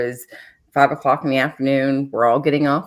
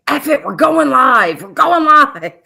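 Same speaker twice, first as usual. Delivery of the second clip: high, loud imitated rant; mock yell; 9 semitones higher, 7 dB louder than usual. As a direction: blow up like a furious newsman. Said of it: "the news, uh, guy that said".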